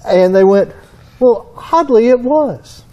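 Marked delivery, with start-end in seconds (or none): 1.2-2.8 higher-pitched mock British accent. Metallurgists admit an odd result, in a mock British accent. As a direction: imitate a surprised expert in a British accent. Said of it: "they said in a British accent".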